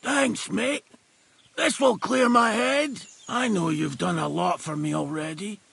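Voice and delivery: normal talking voice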